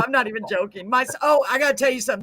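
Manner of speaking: ordinary delivery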